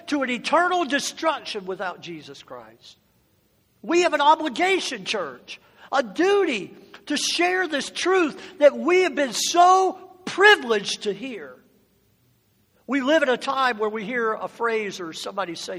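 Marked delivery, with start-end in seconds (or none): 3.8-11.5 shouting preacher. Acted. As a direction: cry it out to the church.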